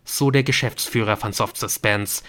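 ordinary delivery

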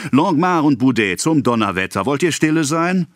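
put-on exasperated priest calling boys to order; scolding call. A narrator's reading. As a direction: call out sharply to rowdy boys. Priest scolds noisy boys mid-conversation.

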